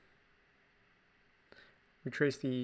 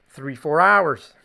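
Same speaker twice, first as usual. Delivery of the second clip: drawn out, much louder mock boast; joking exaggeration; 14 dB louder, slower than usual. Artist kidding viewers about video length, loud exaggeration. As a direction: blow it up loudly and slowly.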